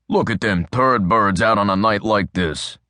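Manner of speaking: a hint of a hardened detective sneering; gruff cop Adams. A narrator's reading. Adams voiced by narrator.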